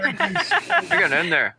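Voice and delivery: high, slow, drawn-out repeat; teasing singsong echo; mock high echo. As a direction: stretch it out in a silly high voice. Mocking repeat of own line.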